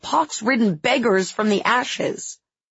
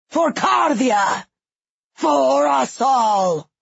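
rallying cry; 5 semitones higher, slower than usual. A narrator's reading. King rallies the crowd.

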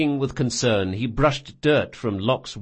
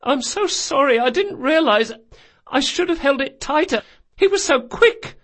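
high, tearful self-blame; sobbed apology; 4 dB louder than usual. Acted. Boy to old woman about the theft, high sobbing apology. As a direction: wail excuses through put-on sobs. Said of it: "Locke sobbed".

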